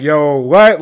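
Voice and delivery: loud, high, stunned outburst; retold astonishment. Co-host reliving the cliffhanger, loud astonishment. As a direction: burst out in amped-up disbelief.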